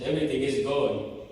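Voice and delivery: ordinary talk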